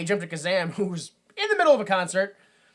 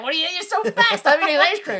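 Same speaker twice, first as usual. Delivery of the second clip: high, loud scolding; mock yelling; 11 semitones higher, 7 dB louder, faster than usual. Parent yells at the kid over ice cream, mock scolding. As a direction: yell it as a hammed-up angry parent. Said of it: "the kid gets yelled at".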